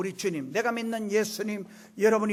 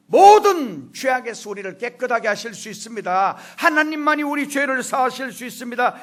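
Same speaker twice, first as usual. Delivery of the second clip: raised, emphatic pulpit proclamation; forceful preaching; 5 semitones higher, 7 dB louder than usual. Real, not acted. Pastor proclaiming forgiveness to the congregation, fervent. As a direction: drive each declaration home to the congregation.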